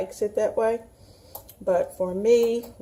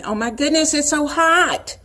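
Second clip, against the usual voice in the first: higher, louder, slowed mock gasp; surprised exclaim; 7 semitones higher, 5 dB louder than usual. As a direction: imitate a viewer stunned by heat. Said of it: "You're thinking".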